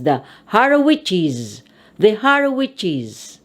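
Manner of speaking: "the Harowiches" with an added -iz syllable; plural ending. Shown as a demonstration. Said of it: "We refer to them as".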